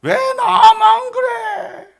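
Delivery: very high, drawn-out protest shout; acted loud complaint. General grumbling at being singled out, hammed-up high shout. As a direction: wail it in mock outrage. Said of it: "불평".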